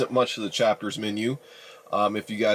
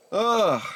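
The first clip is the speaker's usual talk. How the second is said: higher-pitched, fed-up "ugh"; exasperated groan; 7 semitones higher than usual. Real, not acted. Vlogger stuck without DVD audio, exasperated groan. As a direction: groan at a gadget that won't work.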